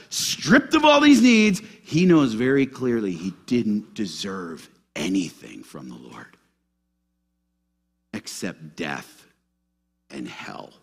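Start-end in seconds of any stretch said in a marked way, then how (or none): 1.8-10.7 hushed. Shown as a demonstration. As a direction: drop to a low, quiet voice. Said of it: "I'm gonna say it again".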